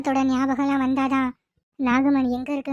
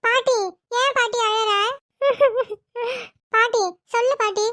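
put-on little girl's voice; 9 semitones higher than usual. Little granddaughter questions crying grandmother.

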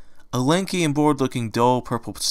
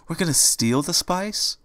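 higher, slow shocked question; aghast at the idea; timid gasped disbelief; 6 semitones higher, slower than usual. Acted. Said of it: "said in shock".

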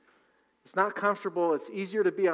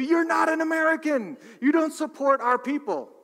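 much higher, louder indignant farmer; mock farmer yelling; 11 semitones higher, 6 dB louder than usual. The farmers union yelling at the shopper, mock indignant. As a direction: bellow it like a proud union farmer. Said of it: "the farmers union starts yelling at you".

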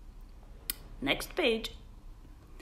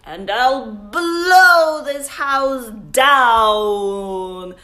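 loud, slow growled wolf threat; booming storybook wolf; growling wolf; 8 dB louder, slower than usual. Acted. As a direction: growl it out like the big bad wolf. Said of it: "growled the wolf".

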